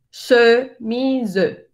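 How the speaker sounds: chemise with a rounded schwa, slow; French chemise. Shown as a demonstration. Teacher modelling a French word for the class. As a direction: say chemise slowly with rounded lips. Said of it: "al final también tiene otra E".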